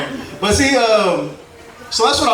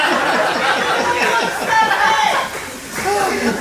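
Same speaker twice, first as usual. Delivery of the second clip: high burst of laughter to open; big laugh; 11 semitones higher than usual. Real, not acted. Comic laughing at the mic before his set.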